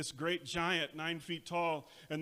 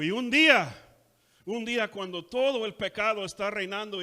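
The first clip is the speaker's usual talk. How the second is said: high, loud shouting sermon climax; fiery preaching; 4 semitones higher, 9 dB louder than usual. Retold or imitated. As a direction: preach it at full force, building to a shout. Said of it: "y luego dijo".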